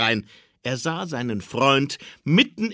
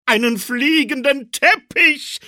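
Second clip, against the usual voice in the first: hoch und laut angepriesen; Weihnachtsmann, verlockend; 12 semitones higher, 6 dB louder than usual. A narrator's reading. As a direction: verkauf ihm den Teppich. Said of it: "Weihnachtsmann lockt Olaf". (Weihnachtsmann lockt Olaf, hoch und laut)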